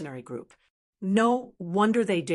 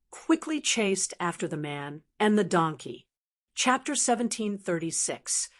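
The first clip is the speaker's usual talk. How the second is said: ordinary delivery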